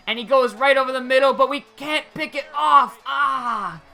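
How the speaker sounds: real exasperation at a missed chance; frustrated play call. Real, not acted. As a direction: call the near miss with rising frustration. Just misses an interception.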